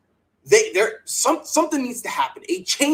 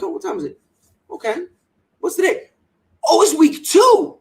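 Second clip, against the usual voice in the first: mock puzzled; 8 semitones higher, 5 dB louder than usual. Retells own puzzled reaction.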